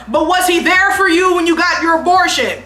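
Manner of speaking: loud, high call across the car; yelling across. Retold or imitated. Friend yelling across the car to wake her up, loud and high. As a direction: yell it over the car to break his spell. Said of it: "I had to yell from the other side of the car".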